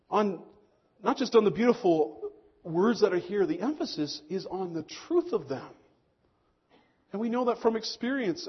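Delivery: ordinary talk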